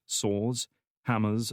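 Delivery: ordinary delivery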